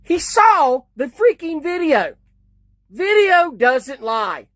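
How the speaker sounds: indignant. Real, not acted. Commentator outraged that the cop ignored the video, slow and indignant. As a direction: spell out the obvious, slowly.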